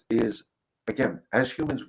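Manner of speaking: ordinary talk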